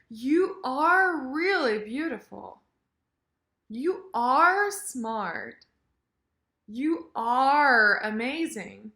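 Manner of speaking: slow compliments with "are" stretched out; emphatic "are". Shown as a demonstration. Teacher models emphasis on verb, stressed "are". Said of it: "more emphasis on the verb".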